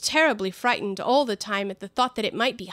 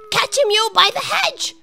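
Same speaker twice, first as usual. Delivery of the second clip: high, loud rabbit barking orders; rabbit shouting; 12 semitones higher, 8 dB louder than usual. Acted. Rabbit shouting to the others to catch Bill, high and loud. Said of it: "the rabbit's voice alone".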